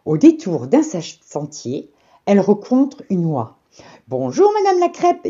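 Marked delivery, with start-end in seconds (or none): 4.1-5.3 high sing-song goose greeting; a chirpy high goose. A narrator's reading. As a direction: pitch it up like a polite goose. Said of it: "dit l'oie".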